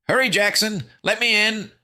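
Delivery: high, loud desperate plea; panicked high cry; frantic whimper. A narrator's reading. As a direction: beg loudly to be let in. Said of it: "Fancy Pants whimpered".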